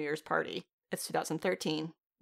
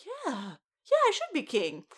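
mock dawning conviction; 10 semitones higher than usual. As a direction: imitate a man warming to the idea, pitched up. Voices a husband being persuaded.

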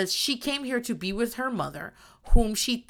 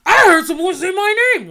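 loud, high, hammed-up cry of accusation; mock dramatic outburst; 8 semitones higher, 10 dB louder than usual. Mocking Natalie storming in, loud hammed-up outrage. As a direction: burst in hamming up the outrage. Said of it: "As opposed to being like".

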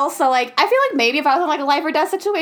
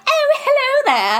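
high, slow bad British accent; bad British accent greeting; mock British accent; 11 semitones higher, slower than usual. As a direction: put on a bad posh British voice. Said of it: "I don't know why we're doing that".

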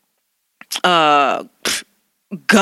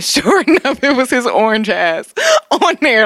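laughing; 4 semitones higher than usual. Real, not acted. Laughs while telling.

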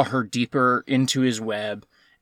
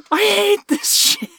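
high, drawn-out squeal of frustration; laughing exasperation; 3 dB louder, slower than usual. Real, not acted. Film watcher done with the movie, laughing in exasperation. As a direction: squeal it between fits of laughter. End of outraged rant, amid laughter.

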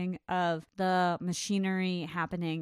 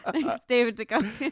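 laughing sign-off; through laughter; 3 semitones higher, 4 dB louder than usual. Real, not acted. Co-host thanking the director after the joke pitch, laughing. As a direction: squeeze the words out while laughing.

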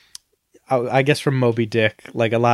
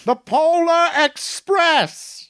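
much higher, very slow mock scream; Tom Hanks screaming; 11 semitones higher, much slower than usual. Tom Hanks playing every Polar Express role, mock screaming. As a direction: stretch it out in a hammed-up yell. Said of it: "Tom Hanks is just secretly doing that voice".

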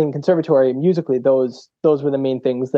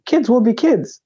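mock principal; 8 semitones higher than usual. Principal voiced in retold story.

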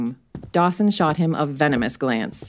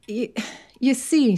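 hesitant; 7 semitones higher than usual. Acted. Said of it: "said Merton".

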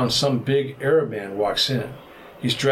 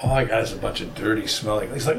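normal talking voice

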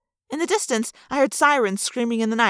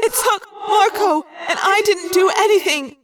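put-on high, loud guilty girl; young girl blaming herself; Cassie's voice; 8 semitones higher, 4 dB louder than usual. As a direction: confess it high and stricken. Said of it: "Cassie said again".

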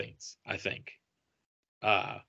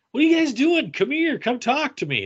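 high, raised beckoning voice; retold calling over; 14 semitones higher, 4 dB louder than usual. Actor beckoning waiting fans to his booth, calling out. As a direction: call them over, starting loud and very high.

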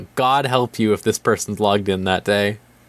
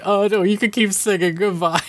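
mock parents; 9 semitones higher than usual. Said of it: "and they're like".

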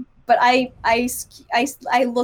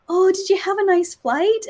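put-on higher-pitched, as if to a child; kindly customs lady; 8 semitones higher than usual. Customs lady asking a young-looking traveler, cooing. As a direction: imitate an over-friendly official greeting a youngster. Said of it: "this poor lady in customs is like".

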